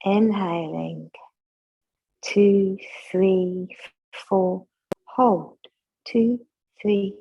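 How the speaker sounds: slow, even counting of each breath; guided breath count. Acted. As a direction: count them through the breath, unhurried.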